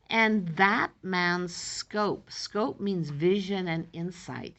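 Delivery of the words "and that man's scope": very slow, louder recital; poem reading. Shown as a demonstration. Teacher reading the sonnet line about scope, very slow. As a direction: draw the line out slowly.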